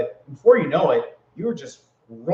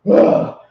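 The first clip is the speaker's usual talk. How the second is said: exhausted groan; 10 dB louder than usual. Retold or imitated. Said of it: "I was like".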